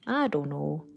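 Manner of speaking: a light Canadian accent on "I don't know". Shown as a demonstration. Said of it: "Did that make me sound really Canadian".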